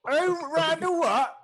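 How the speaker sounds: high, slow shouted question; loud orc innkeeper. Acted. Orc barkeep demands the newcomer's surname, loudly bellowed. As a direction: bellow it in disbelief.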